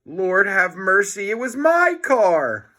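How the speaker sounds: aghast complaint; outraged car owner. Acted.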